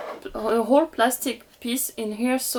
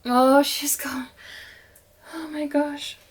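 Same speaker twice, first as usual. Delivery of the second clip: slow breathless whisper; relieved whisper as the doll comes loose; 5 semitones higher, slower than usual. Real, not acted. Doll finally freed from box.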